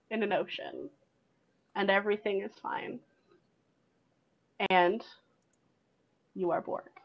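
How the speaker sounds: soft, low, settling narration; hushed calm. A narrator's reading. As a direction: let the storm die away into stillness. Game master narrates calm after chaos.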